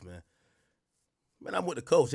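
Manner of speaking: normal talking voice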